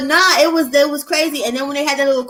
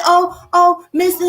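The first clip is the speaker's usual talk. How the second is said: higher, louder imitation of a tattletale; mock tattling plea; 6 semitones higher, 4 dB louder, slower than usual. Girl running to tell the sergeant on her, mock tattling plea. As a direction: mimic her calling for the sergeant's help, raised pitch.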